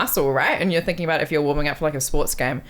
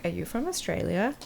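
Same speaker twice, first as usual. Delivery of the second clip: put-on softer American accent; imitated American accent; 8 dB quieter than usual. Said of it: "That's a really good accent".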